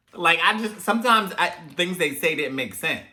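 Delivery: ordinary talk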